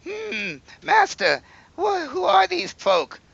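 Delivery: very high timid servant voice; squeaky servant. Acted. Game master voices the servant Jerome asking his master. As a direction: squeak it like a nervous little henchman.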